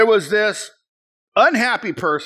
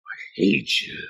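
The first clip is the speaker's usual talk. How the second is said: whispered mock; 10 semitones lower, 4 dB quieter than usual. Repeating the accuser's words.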